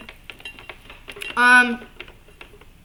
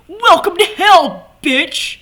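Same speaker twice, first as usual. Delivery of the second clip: higher, louder, slow would-be tough line; mock badass Moxie; 7 semitones higher, 8 dB louder, slower than usual. Fan mocking Moxie's try-hard tough greeting, hammed-up. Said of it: "trying to act bad, all badass".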